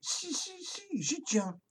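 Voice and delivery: lightly voiced rooster insisting; eager rooster. A narrator's reading. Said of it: "dit Coquelicot".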